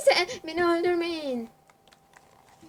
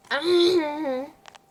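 loud burst of laughter; 2 semitones higher, 7 dB louder than usual. Real, not acted. Streamer laughing out loud after her own taunt.

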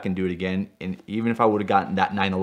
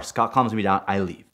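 ordinary talk

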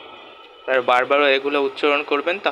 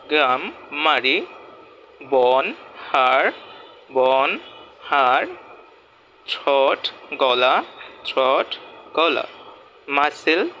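slow demo; slower than usual. Shown as a demonstration. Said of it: "প্রনাউন্সিয়েশনগুলো একটু খেয়াল করবেন".

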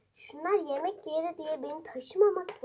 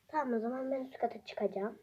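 whispering; 10 dB quieter, faster than usual. Real, not acted. Whispered, fast.

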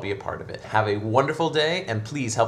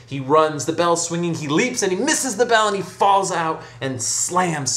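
louder, higher climax of the tale; dramatic storytelling; 6 semitones higher, 5 dB louder than usual. A narrator's reading. Storyteller at the hunchback's fatal leap, dramatic. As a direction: build high and loud, stretching out the fall. Narrator builds to the fatal fall.

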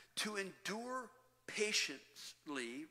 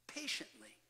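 whispered after a weighty pause; low, unvoiced-sounding hush; 6 semitones lower, 4 dB quieter than usual. Real, not acted. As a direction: drop to a whisper for the key word. Dramatic hush on the key word.

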